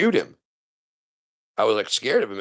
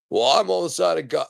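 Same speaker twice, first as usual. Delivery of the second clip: mock gun owner voice; 10 semitones higher, 3 dB louder than usual. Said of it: "You're on the side of God".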